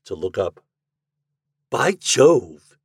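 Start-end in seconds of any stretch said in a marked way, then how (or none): 1.7-2.8 higher, louder exclamation of delight; raised, emphatic outburst; exclaimed cry. A narrator's reading. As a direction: cry out in startled wonder. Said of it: "he cried".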